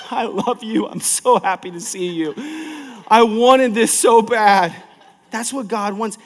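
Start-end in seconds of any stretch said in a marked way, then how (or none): 0.1-4.7 higher, laughing, eager mock devotion; mock gushing love. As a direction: gush like you've waited all week. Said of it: "Or the person who's like".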